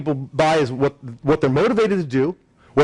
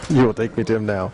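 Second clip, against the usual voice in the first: lightly voiced, a bit lower hypnotic command; Jedi mind trick; 6 semitones lower than usual. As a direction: echo the mind trick half in jest. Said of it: "that Jedi mind trick".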